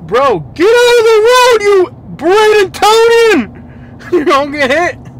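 high, loud drawn-out real shout; real exasperated holler, high and loud; yelling warning. Real, not acted. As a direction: yell at someone in the road. Yelling at someone in the road.

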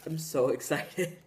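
ordinary talk, nothing marked

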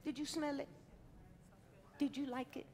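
usual speaking voice

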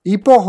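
"epoch" said with a long EE at the start. Shown as a demonstration. Player weighing up two readings, long-E epoch. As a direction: say "epoch" as EE-pock. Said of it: "I'm gonna say epoch".

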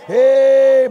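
loud, sustained "hey"; long preacher's holler. Real, not acted. Preacher rallies mamas to applaud, long loud holler. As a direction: holler it out and hold it.

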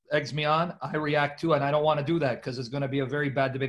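ordinary delivery

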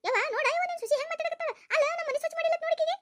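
high, fast, whining complaint; whiny tattling. Acted. Sister-in-law tattling to her mother about the daughter-in-law, fast high whine.

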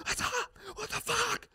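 high, strained imitation of a crazy guy; held-back mock screaming at a reflection; hyping himself up. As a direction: psych yourself up with pent-up mock aggression. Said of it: "He's getting hyped up".

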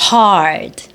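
"hard" with a long, far-carried R; careful model "hard", R held long. Shown as a demonstration. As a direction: draw out the "ar" of "hard". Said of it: "R 해서 길게, 멀리, 높이 발음하시면".

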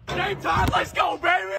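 high, shouted team hype. Acted. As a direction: fire up the team at full voice. Hypes up the team.

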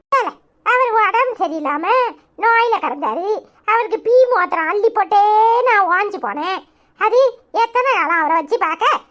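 granny justifying herself with a small laugh; defensive grandmother. Acted.

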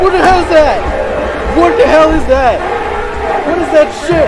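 high, very loud shock; freaked out, yelling at a monster; panicked screaming. Real, not acted.

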